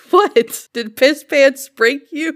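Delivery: high, loud, on the edge of laughter; amused teasing. Real, not acted. Host asking a cracked-up co-host, laughing and incredulous. Cracking up over a silly phrase.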